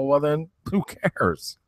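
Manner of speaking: challenging him with an absurd comparison; mock indignant. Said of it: "I go".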